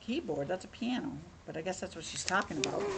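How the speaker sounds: low, soft musing to oneself; muttered aside. Real, not acted. Reader looking at the picture, quietly puzzled. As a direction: think aloud quietly, low.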